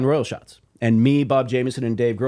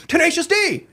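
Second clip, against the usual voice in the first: much higher, excited cry of the band's name; excited shout. Retold or imitated. Telling bandmates, reliving a starstruck much higher cry. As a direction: burst out like a starstruck fan. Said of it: "and I'm like".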